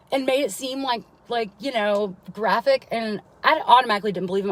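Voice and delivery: ordinary talk, nothing marked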